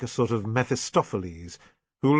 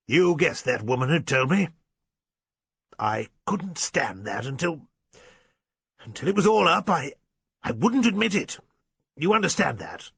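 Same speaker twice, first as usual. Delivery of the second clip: halting, effortful squire, pitch jumping up; shaken squire; 5 semitones higher, 3 dB louder than usual. A narrator's reading. Squire admits what he was told, shaken and halting. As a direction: force out the admission, breaking off and restarting.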